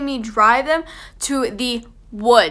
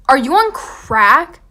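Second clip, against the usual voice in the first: louder, slow disbelief rising at the end; incredulous; 2 semitones higher, 7 dB louder, slower than usual. Retold or imitated. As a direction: ask like they've lost their mind. Refusing friends' crazy idea.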